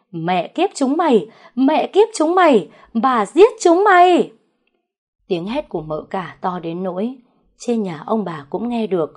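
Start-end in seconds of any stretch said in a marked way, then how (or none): 0.1-4.3 building to a full furious scream; screaming curse. A narrator's reading. Wife screaming at her husband's lover, furious scream. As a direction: scream it louder each time, out of control. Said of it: "Tiếng mợ cả gào lên".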